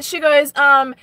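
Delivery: normal talking voice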